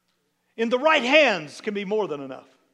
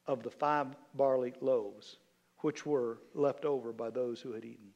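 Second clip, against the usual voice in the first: scripture reading; 5 semitones lower than usual. Acted. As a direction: read the passage to the congregation. Reads scripture passage.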